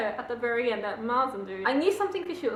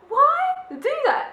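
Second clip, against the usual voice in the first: high, slow, loud why; exasperated why; outraged; 14 semitones higher, 5 dB louder, slower than usual. Real, not acted. Outraged rhetorical question.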